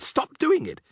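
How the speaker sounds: put-on high, urgent boy's plea; protesting boy. A narrator's reading. Boy ordering the bully to stop, high and urgent. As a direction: demand he stop. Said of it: "I cried".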